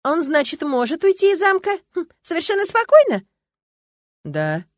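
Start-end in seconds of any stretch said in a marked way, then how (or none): 0.0-3.2 very high, loud incredulous question; indignant. A narrator's reading. Girl challenges Erion about the castle, indignant. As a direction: demand an answer in outrage. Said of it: "с возмущением уставилась на него".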